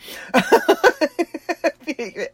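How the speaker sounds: high, giggly burst of laughter; long high-pitched chuckle; amused laugh. Retold or imitated. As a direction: laugh in bashful surprise at the request. Reacting to being asked to record.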